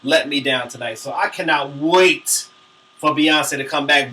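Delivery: usual speaking voice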